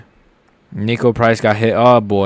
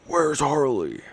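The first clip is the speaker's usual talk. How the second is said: a hint of a gravelly Batman, softer; Arkham Batman impression; 3 semitones higher, 6 dB quieter, slower than usual. Joking about the game while watching the fight, light Batman voice. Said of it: "Like Arkham Batman".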